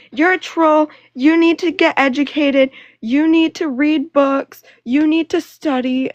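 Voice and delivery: loud, high hammed-up shouting; mock screaming. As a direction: mimic someone hurling accusations at full volume. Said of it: "would scream that I was a troll".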